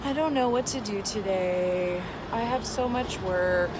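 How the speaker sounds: slow, drawn-out worried self-talk; slow, wrong way. Shown as a demonstration. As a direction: drag it out like you're unsure. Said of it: "that's not gonna be as effective as going really fast".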